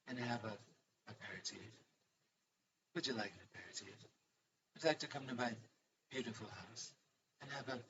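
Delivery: lightly hushed, dreamy Ina Garten invitation; Ina Garten impression. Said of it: "That's how she speaks".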